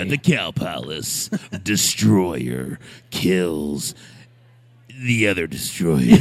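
booming slow announcer voice; monster truck ad. Retold or imitated. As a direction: drag it out like a truck rally ad. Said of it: "Monster Truck commercial".

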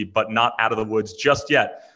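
ordinary talk, nothing marked

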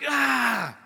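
demonstrated shout of abandon; open-armed cry. Shown as a demonstration. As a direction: let out a sustained open cry. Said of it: "Like, just like".

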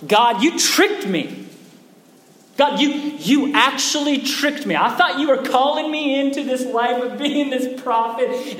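high, loud, aggrieved protest; indignant complaint. Acted. Prophet complains to God of being tricked, indignant cry.

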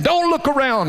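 visiting preacher. Retold or imitated. Visiting pastor ordering the children to bow, mock preacher call. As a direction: raise the pitch and command the room.

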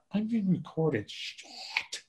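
hushed, drawn-out whispered curse at the end; dismayed realisation. Retold or imitated. Sculptor realising the camera caught him cussing, dismayed hush. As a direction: trail into a long whispered swear. Retold realisation of being filmed.

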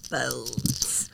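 deep spooky hush on Bones. Shown as a demonstration. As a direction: drag out Bones like a haunted house. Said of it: "That's how you have to say it".

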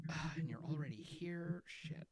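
quiet dismayed mumble; muttering under her breath; quiet muttered quote. Retold or imitated. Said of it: "She goes".

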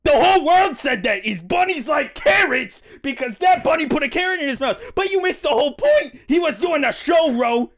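high, exasperated near-shout; indignant rant. Acted. Chester lecturing Ro on the carrot myth, high and indignant. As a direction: rant it with rising outrage.

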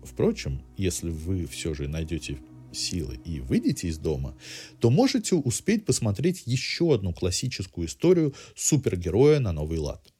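ordinary talk

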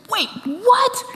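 very high, incredulous question; stunned double take. Retold or imitated. Mary turns and recognizes Jesus, comically high-pitched disbelief. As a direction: squeak it in mock astonishment. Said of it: "that was kind of the attitude".

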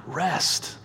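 rest dropped to a hushed, drawn-out near whisper; soft, lingering rest. Shown as a demonstration. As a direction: echo the breathed word rest softly. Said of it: "say it slowly and quietly".

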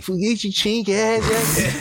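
high, slow mock drill instructor, laughing; drill sergeant yell. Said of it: "so he's raised like".

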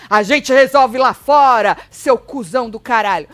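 hammed-up belligerent yell; mock aggressive shout. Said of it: "Por mais que ele não tenha falado".

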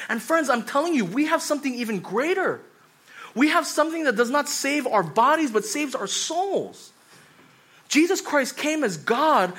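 high, loud sermon climax; preaching at full force. Acted. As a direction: build it like a pulpit altar call.

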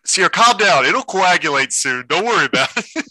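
high-pitched playful placating; mock reassurance. Acted reassurance to upset Sarah.

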